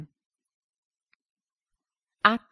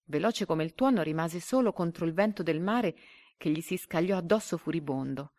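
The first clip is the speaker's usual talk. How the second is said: usual speaking voice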